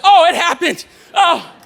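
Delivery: laughing mock scream of pain; pained yell. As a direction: yelp as the spray hits. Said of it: "screaming at the top of my lungs".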